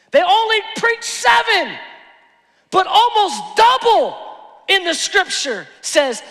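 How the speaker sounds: loud, high, emphatic sermon delivery; full-force preaching. Real, not acted. Preacher corrects half-preached verse for congregation, shouted preaching. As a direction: proclaim it at full voice.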